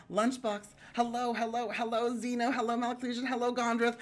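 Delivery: breathless run of greetings, pitched high; running out of breath. Real, not acted. Streamer greeting viewers in one breath, running out of air. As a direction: rattle through greetings on one held high note. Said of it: "I'm out of breath, y'all".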